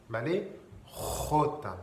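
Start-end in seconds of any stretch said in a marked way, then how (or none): 1.3-1.8 "jota" opening on a rasped throat sound; deep throat jota. Shown as a demonstration. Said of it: "en espagnol". (« jota » avec un R plus profond, en gorge)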